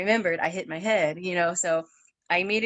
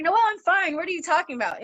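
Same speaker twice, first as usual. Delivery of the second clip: high, louder retold protest; high-pitched "I'm fine" insistence; insistent protest; 11 semitones higher, 4 dB louder, faster than usual. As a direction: act out brushing off the worry. Insisting she's fine to worried sister.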